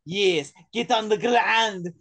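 slow cartoonish Russian accent; over-the-top mock Russian accent; bad Russian accent. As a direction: drag out a hammed-up Russian villain's command. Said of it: "tries to pull off the Russian accent".

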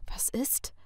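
near-whispered, soft query; hushed question. A narrator's reading. Partner finds her in shock, asking in a hush. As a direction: ask it softly, almost without voice.

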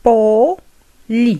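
"Poli" split into po, then li; syllable by syllable. Shown as a demonstration. Teacher modelling for learners, syllable by syllable "Poli". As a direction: pause between the two syllables of "Poli".

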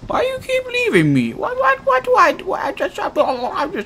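high whiny voice. Pretend. Whiny pleading monster.

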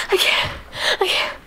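breathless gasps with barely voiced words; mimicked panicky over-breathing; imitated hyperventilating. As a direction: act out panting between the words. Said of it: "She's, like, hyperventilating".

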